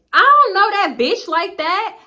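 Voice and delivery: slow, high, loud mock of the friend; mocking her. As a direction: drag it out like a dismissive friend. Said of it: "This hoe had the nerve to say".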